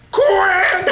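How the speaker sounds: loud, high shout of a name; sudden mock-scolding holler; imitated mom's yell. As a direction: yell it like a mother catching her kid. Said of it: "she just yells at him".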